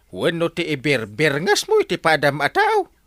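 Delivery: normal talking voice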